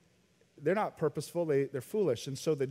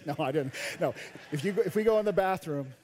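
ordinary delivery

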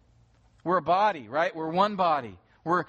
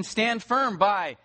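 slow emphatic command; 5 semitones higher, much slower than usual. Acted. Paul commanding believers to stand, slow and firm. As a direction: Stand firm drawn out slowly and raised. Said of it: "And in verse fourteen".

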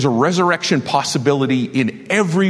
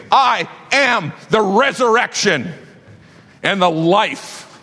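proclaimed shout; 6 semitones higher, slower than usual. Acted. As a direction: lift the words up over the room. Preacher voicing Jesus's promise.

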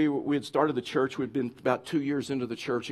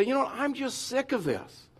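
fed up; 9 semitones higher, 4 dB quieter than usual. Acted. Preacher voicing Israelites after years of manna, fed up. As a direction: give up in a high, weary mock whine.

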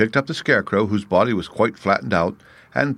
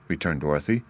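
plain reading voice

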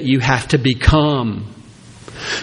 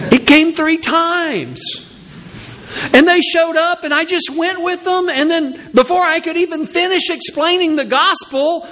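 much higher, pleading and flustered; defensive Peter; 13 semitones higher than usual. Retold or imitated. Peter defending himself before the church, high and pleading. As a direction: play Peter whining it wasn't his fault. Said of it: "Peter says".

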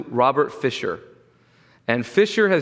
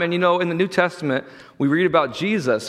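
ordinary talk, nothing marked

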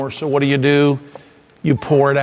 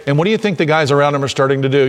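ordinary delivery

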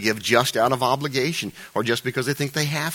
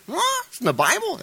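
high-pitched, startled questioning; mock incredulity. Preacher playing someone shocked by a verse on giving, incredulous. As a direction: squeak it out in mock surprise. Acts a surprised reaction to verse.

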